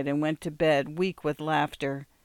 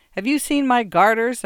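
higher, louder question to a partner; calling out; 7 semitones higher, 7 dB louder than usual. A narrator's reading. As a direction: call out impatiently for a lost item. Man calls out to his wife.

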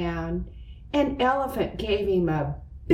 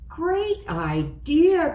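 higher, slower excited cry; 8 semitones higher, slower than usual. A narrator's reading. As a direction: cry it out with delight. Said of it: "cried Elephant".

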